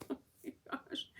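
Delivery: breathless whispered oh my gosh; soft stunned whisper; whispered aside. Retold or imitated. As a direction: hush it to yourself, overwhelmed. Overwhelmed reaction to nurse.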